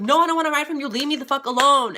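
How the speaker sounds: high, loud panicked protest; scared refusal. Retold or imitated. Scared, yelling off a stalker.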